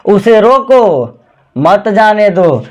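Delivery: drawn-out "उसे रोको", clear gap, slow "मत जाने दो"; pause after रोको. Shown as a demonstration. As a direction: halt at the comma between रोको and मत. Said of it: "तो यहाँ कुछ तो रुकना होगा".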